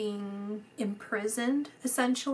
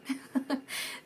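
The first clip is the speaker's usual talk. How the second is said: soft laugh; 4 semitones higher, 7 dB quieter than usual. Real, not acted. Laughs after quoting.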